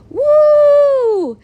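long, high, loud mock whoop; imitated wooing. Imitating her date whooping on the roller coaster, wooing loudly. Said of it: "the way he was wooing".

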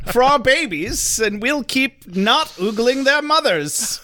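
high, slow ad voice through laughter; mock commercial sign-off. Finishing the Plot Hole Emporium ad, hammed-up announcer. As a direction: drag out the silly slogan, half laughing.

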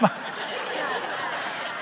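wheezing laugh with no voice; breathless laughter at the crowd; silent breathy laugh. Real, not acted. Laughs at own joke.

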